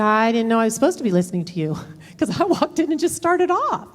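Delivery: lightly voiced husband, laughing, then rising high in protest; a hint of the put-upon husband; husband protesting it's not fair; husband's protest. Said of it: "And he said".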